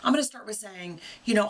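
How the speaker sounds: ordinary talk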